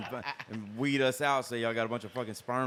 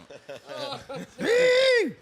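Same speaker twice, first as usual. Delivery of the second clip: high cackling gobble; turkey gobble laugh; 10 dB louder than usual. Acted. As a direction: let out a loud gobbling cackle. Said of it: "wild turkey voice".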